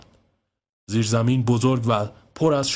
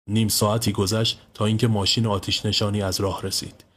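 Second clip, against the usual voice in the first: usual speaking voice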